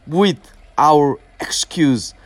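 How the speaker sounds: normal talking voice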